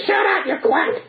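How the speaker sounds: high slow puppet jeer; Punch squawk. Retold or imitated. Punch beating the quack doctor, high slow puppet jeer. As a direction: sneer it in Punch's squeal.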